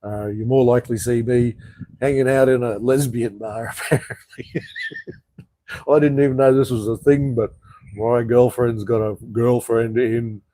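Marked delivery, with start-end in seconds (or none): 4.6-5.1 short high giggle; high laugh. Real, not acted. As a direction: let out a quick high laugh. Laugh alone on line.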